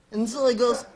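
ordinary talk

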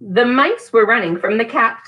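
lively storybook reading with voiced mice; reading to children. Teacher reading Mouse Shapes to children, lively storybook reading. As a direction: read aloud for toddlers, hamming the characters. Reads picture book to children.

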